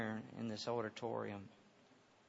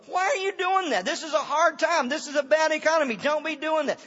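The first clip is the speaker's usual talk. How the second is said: hint of a doubting neighbor, loud and high; lightly voiced fretful crowd; mock skeptic; 14 dB louder than usual. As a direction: play the worried naysayer. Mocking skeptical people.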